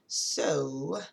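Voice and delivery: "So" stretched long on the O; draggy, elongated "So"; drawn-out SO. Real, not acted. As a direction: hold "So" for four O's' worth. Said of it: "there's four O's after the S".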